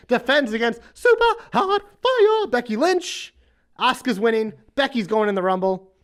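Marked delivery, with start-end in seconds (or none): 0.1-3.3 ring announcer. Pretend. Announcer hyping the challenger.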